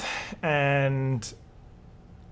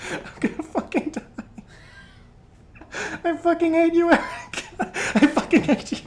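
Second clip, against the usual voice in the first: high, strained curses broken by laughter; real laughing rage at Eric; 10 semitones higher than usual. Real, not acted. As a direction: squeal it through the laughing. Reacting to the rival Eric.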